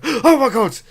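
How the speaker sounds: high, loud alarmed kobold cry, easing down; panicked kobold. Acted. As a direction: shriek at the stranger, then accuse. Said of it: "they look at you and they go".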